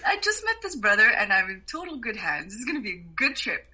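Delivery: high and loud at the start, thrilled; delighted. Retold or imitated. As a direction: gush with happy relief. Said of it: "And I'm just like".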